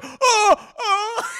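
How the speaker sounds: high, loud wailing "oh, oh"; mock panicked reaction. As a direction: wail helplessly, hammed up. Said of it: "this guy's reaction is like".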